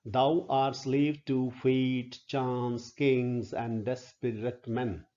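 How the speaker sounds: "Thou art slave to fate" read slowly, word by word; slow metrical recitation. Shown as a demonstration. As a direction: scan the line slowly and deliberately. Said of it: "So if you look at this".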